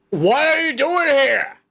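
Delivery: high, loud baffled guard; imitating a guard, loud and high; mock guard. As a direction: bellow it like a stunned guard. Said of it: "even the guard was like".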